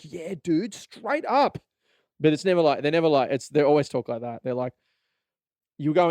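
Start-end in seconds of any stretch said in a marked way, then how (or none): 0.0-1.6 rising to very high; keen staffer. Retold or imitated. Enthusiastic staffer answering a customer, mock keen. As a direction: gush with enthusiasm.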